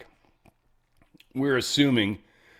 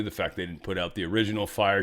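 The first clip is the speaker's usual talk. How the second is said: ordinary delivery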